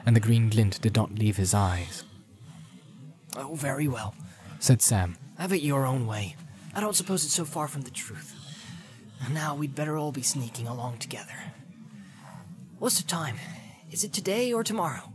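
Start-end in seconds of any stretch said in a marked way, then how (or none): 3.3-15.1 hushed grumbling hobbit; a hint of a resigned hobbit; lightly voiced weary Sam. Sam speaks to Gollum.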